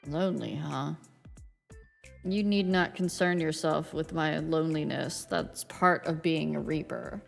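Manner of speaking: Grim Reaper voice. Acted. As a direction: aloof death god, calm and low. Death's game lines voiced.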